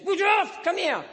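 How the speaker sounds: loud, high barked call; drill sergeant. Retold or imitated. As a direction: yell it like a sergeant major. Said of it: "and the sergeant major says".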